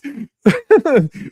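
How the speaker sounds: high, loud real laugh; big laugh. Real, not acted. Cracking up at the crow joke, loud high laugh.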